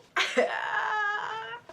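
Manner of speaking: high-pitched drawn-out wail; mock crying. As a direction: squeal as if about to burst into tears. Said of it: "I was literally crying".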